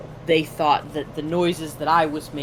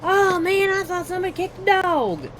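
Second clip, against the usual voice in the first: high, surprised shopper voice; surprised stranger; 12 semitones higher than usual. Retold or imitated. Retelling a Walmart stranger's shock, high and surprised. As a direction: pitch way up like a startled stranger. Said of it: "he's like".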